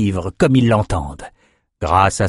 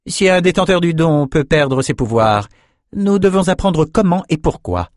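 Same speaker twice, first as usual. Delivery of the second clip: high-pitched, measured woman ruler; high, authoritative council voice; haughty ruling sorceress; 8 semitones higher than usual. A narrator's reading. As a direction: speak as a high-voiced, cautious sovereign.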